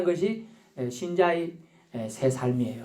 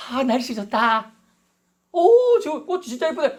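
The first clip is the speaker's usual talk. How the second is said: high, drawn-out cooing delight; mock admiration; 6 semitones higher than usual. Preacher playing a driver admiring weather and flowers, mock delighted. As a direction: gush dreamily at the view, hammed up.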